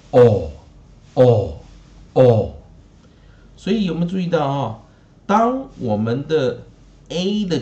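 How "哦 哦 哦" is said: 哦 as a loud, isolated rounded vowel; variant "oh" sound. Shown as a demonstration. Teacher shows the variant reading of A, rounded 哦. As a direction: model 哦 loudly, with a pause after each. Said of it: "破音字就念哦".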